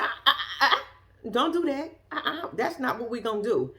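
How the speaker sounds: wag a finger through laughter; laughing no-no. Real, not acted. Vlogger laughing at the roommate's regret, laughing refusal.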